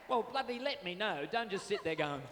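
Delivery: normal talking voice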